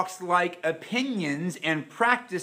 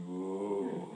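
bear growl; 9 semitones lower, 8 dB quieter than usual. Retold or imitated. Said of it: "the big bear out there going".

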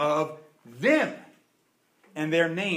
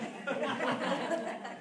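laugh; 7 semitones higher, 10 dB quieter than usual. Real, not acted. Laughing at own joke.

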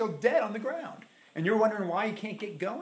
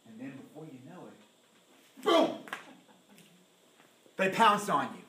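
ordinary talk

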